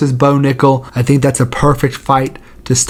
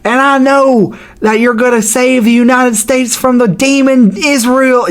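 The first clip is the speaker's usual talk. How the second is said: imitating Bryce Mitchell; 8 semitones higher than usual. Fighter addresses Trump at a post-fight presser, much higher put-on voice. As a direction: ham up a fervent fighter's callout to Trump. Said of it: "be like".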